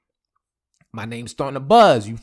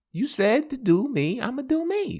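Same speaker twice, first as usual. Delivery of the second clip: smug ex voice; 11 semitones higher than usual. Retold or imitated. Ex voiced smugly.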